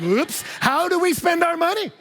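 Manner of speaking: high comic alarm; mock realisation. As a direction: play the sudden dawning surprise. Mock realisation of money conflict.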